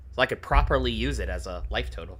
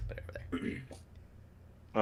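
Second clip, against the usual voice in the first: whisper; 13 dB quieter, much faster than usual. Real, not acted. Muttering to self.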